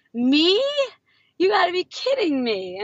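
can't believe it's about him; disbelief. Retold or imitated. Him reacting to the excited coach, stunned disbelief. Amazed at being recognized.

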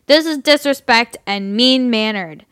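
put-on loud, slow drunken rant. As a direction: rage like an angry drunk. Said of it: "I'll just do my drunk voice".

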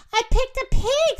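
high, loud, proud announcement; excited pride, voice lifted. A narrator's reading. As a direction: boast of a find. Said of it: "said Emma proudly".

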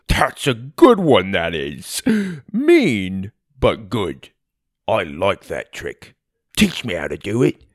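lightly voiced friend, teasing; amused Tim.

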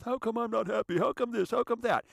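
high-pitched mocking whine; whining complainers. Mimicking listeners who phone and email complaints, high mock whine.

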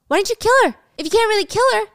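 loud, high furious demand; angry yelling. Acted. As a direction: yell the accusation at her with rising rage. Danny confronting Wendy with knife.

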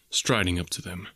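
plain reading voice

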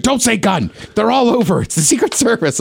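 high, hushed urgent warning. Retold or imitated. Dad warning his kid near the Secret Service, high and urgent. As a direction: warn him fast not to say it. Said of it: "He's like".

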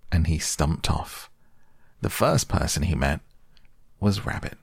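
ordinary delivery

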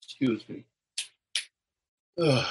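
choked up. Real, not acted. Breaks off after his son's words.

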